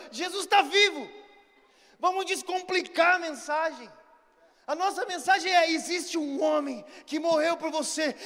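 high, loud evangelistic exhortation; impassioned preaching. Real, not acted. Preacher telling people to go to Jesus, impassioned. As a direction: urge the crowd forward with full force.

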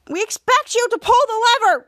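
high, loud fed-up yell; exasperated shout. Retold or imitated. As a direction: shout it at him, out of patience. Team shouts at Captain Man.

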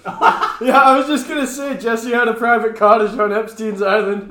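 high, loud, laughing words. Real, not acted. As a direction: talk through your laughter. Laughing through the joke.

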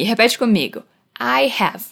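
normal talking voice

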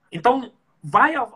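ordinary talk

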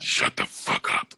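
slow, voiceless mock threat; whispered rebuke. Co-host silencing interrupting friends, slow whisper. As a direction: whisper it slowly to silence them.

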